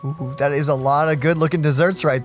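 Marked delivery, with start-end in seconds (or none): none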